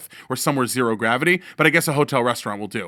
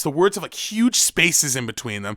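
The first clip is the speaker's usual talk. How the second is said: normal talking voice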